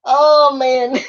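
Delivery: real laughter between and around the words; trying to recover from a laughing fit; cracking up. Real, not acted. Woman laughing at her own story, breaking up.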